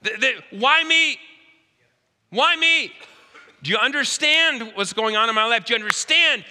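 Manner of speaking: rising to high, loud cries of why me; the widow losing patience with the prophet; exasperated widow. Retold or imitated. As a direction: build from sharp complaint to a desperate outcry. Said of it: "Maybe she's going".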